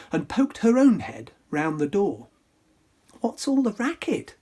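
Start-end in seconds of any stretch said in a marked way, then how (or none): 3.2-4.4 crying out. Acted. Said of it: "Cindy cried".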